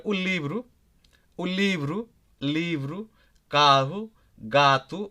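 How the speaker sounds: "livro", "carro", "gato" each said with weight on the first syllable; stressed vowels. Shown as a demonstration. Teacher showing learners the masculine nouns, stressed first vowels in "livro", "carro", "gato". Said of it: "we have some stress on that".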